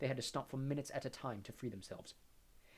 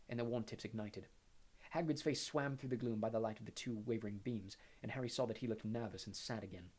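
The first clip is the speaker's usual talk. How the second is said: ordinary delivery, nothing marked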